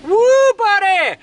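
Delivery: loud high whoop of joy; excited whoop. Real, not acted. Cheering on the drive to the burger joint, loud whoop. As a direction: let out a big happy whoop.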